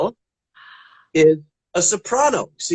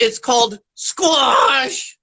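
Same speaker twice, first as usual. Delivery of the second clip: slow punchline; 3 semitones higher, much slower than usual. Acted. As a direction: stretch out the punchline slowly.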